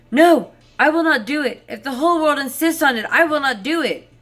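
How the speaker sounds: loud, high protest; crying out with all his might. Acted. Peasant in the barrel shouting his refusal to the passing shepherd, loud and high. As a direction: shout the refusal so the passer-by hears. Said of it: "he cried with all his might".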